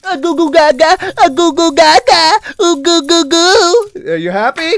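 put-on loud, high baby babble; mocking baby talk. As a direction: coo loudly as if entertaining a toddler. Mock baby talk to entertain viewers.

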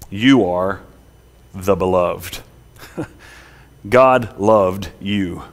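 low, slow, deliberate pulpit delivery; tender preaching. Real, not acted. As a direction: let each word settle on the listeners. Preacher tells congregation they are loved.

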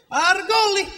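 hint of an unplaced European accent on "Our goalie"; mock soccer-fan accent, proud; lightly accented fan cheer; vague foreign accent. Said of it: "I don't know what accent this is exactly".

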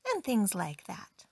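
usual speaking voice

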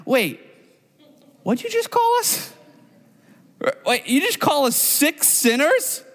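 high gloating cheer that turns to baffled squeak; gloat, then catch the insult; gloating then confused. Retold or imitated. Tax collectors cheering Jesus, then realizing the insult, high.

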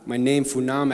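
ordinary talk, nothing marked

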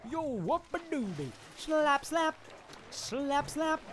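high, loud playful whooping; silly battle cry. Pretend. Player slapping foes in game, silly high shouting. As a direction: squeal nonsense war cries.

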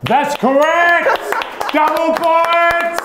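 high, slow game show cheer; excited announcement. Acted. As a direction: announce the win like a host.

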